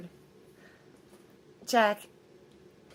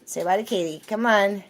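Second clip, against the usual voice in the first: ordinary talk, nothing marked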